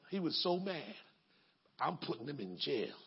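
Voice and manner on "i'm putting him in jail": low, quiet mutter of the creditor; mock creditor. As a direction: say it low and stubborn.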